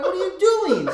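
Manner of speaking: high, loud exasperated question; laughing scold. Retold or imitated. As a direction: shout it in mock outrage. Scolding girlfriend.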